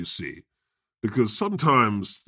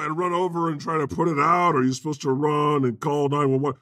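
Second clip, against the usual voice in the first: ordinary delivery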